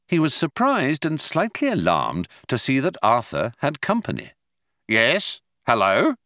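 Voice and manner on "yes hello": calling out. A narrator's reading. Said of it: "he called".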